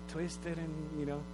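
usual speaking voice

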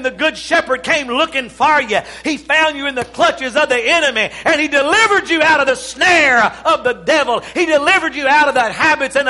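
loud, high preaching build; rousing sermon cry; full-force preaching. Acted. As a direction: preach it out to the crowd.

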